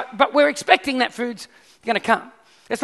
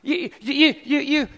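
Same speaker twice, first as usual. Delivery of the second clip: ordinary delivery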